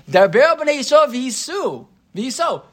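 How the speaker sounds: commanding God. Retold or imitated. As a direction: lay out the order with authority.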